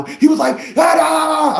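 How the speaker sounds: high, loud frustrated yelling; mock shout. Client yelling at his lawyer, high and loud. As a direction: yell out in exasperation.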